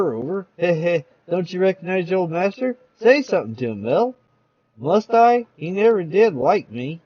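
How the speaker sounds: ordinary talk, nothing marked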